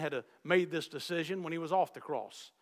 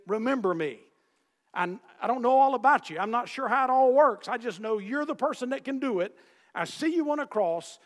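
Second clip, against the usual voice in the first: louder, higher, urgent pleading; earnest plea; 5 semitones higher, 6 dB louder than usual. Retold or imitated. A sinner crying out to Jesus, raised earnest plea. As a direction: beg him with rising urgency.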